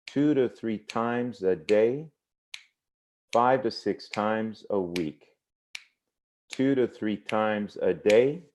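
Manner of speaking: slow, spaced cadence with long pauses; "two to three times a day" spoken slowly like a marching beat; slow measured rhythm. Shown as a demonstration. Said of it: "listen to this".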